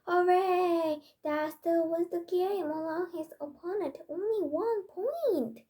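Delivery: high, loud cheer of victory; excited cheer. A narrator's reading. Child celebrating as Dad wins the game, excited cheering. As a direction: cheer it out, pitched well up.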